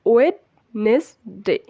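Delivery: "ওয়েডনেসডে" slowly split as "wed-nes-de"; common mispronunciation. Shown as a demonstration. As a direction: sound the d in "ওয়েডনেসডে", three separate syllables. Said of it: "আমরা মূলত এটাকে".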